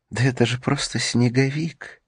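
high relieved laugh through the words; laughing into sobs. Acted. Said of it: "Она громко рассмеялась от облегчения".

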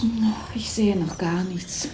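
hushed whisper to her husband; barely voiced, wary whisper. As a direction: breathe the words so nobody else hears. Woman at window at night.

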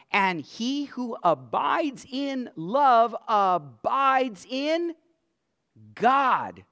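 loud, ringing scripture proclamation; preaching at full force. Acted. Preacher reads First John to the congregation, loud and ringing. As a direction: proclaim it to the back row.

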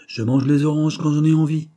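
voix plus haute et plus forte, faussement dédaigneuse; réplique d'enfant qui joue l'indifférence; dédain affecté. A narrator's reading. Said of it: "avec une affectation de dédain". (dédain feint, ton haut et appuyé)

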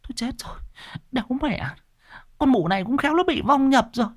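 high, hushed frightened village women; trembling fear. Acted. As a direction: whisper in terror. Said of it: "run run".

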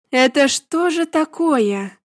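slow, loud commanding demand. A narrator's reading. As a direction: bark it like a commander. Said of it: "сказала я командирским голосом". (командирский голос)

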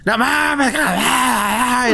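high, drawn-out heckle without full volume; mock crowd yell. Whole row heckling the Batman fan, mock yell. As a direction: stretch it out like a jeering crowd. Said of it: "Y le empezaron a gritar".

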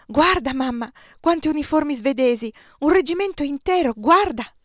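put-on high, loud, eager child; excited boy calling out. A narrator's reading. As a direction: call mamma to look, thrilled. Excited boy calls to his mother.

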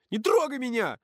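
high, sharp cry of protest; high-pitched indignant exclamation; exclaiming. A narrator's reading. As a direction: cry out to be left alone. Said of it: "воскликнула Маша".

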